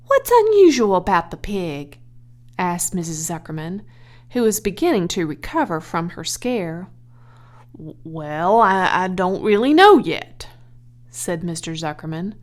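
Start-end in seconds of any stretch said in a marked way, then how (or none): 0.1-2.0 louder, curious wife voice; wife's question. Acted. Edith asks her husband, wary and curious. 7.8-10.5 stammering, louder hesitant reply; stumble, unsure; flustered. Acted. Zuckerman answers his wife, stammering.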